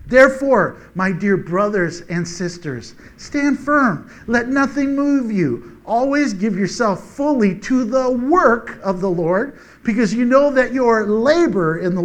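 slow, rising preacher's call; fervent exhortation. Acted. Preacher exhorting brothers and sisters to stand firm, fervent. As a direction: urge them to stand firm.

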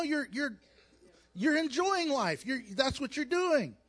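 ordinary talk, nothing marked

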